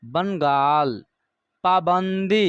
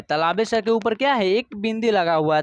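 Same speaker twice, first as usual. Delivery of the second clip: usual speaking voice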